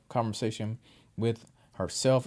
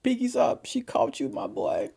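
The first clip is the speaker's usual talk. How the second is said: laughing mock; 8 semitones higher than usual. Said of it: "I was like".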